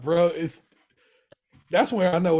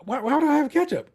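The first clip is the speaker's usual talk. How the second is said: mock Richie; 10 semitones higher than usual. Retelling Richie snapping back at Carmi. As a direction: toss it back, incredulous. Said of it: "He goes".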